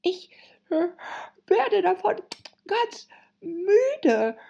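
high hare voice broken by yawns; tired, high-pitched creature; yawning. A narrator's reading. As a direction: yawn between the phrases. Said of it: "unterbrach der Märzhase gähnend".

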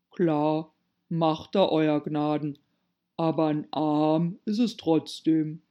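stolid, rustic servant voice; Pat the servant. A narrator's reading. Pat contradicting his master politely, broad stolid drawl. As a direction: insist placidly in a broad drawl.

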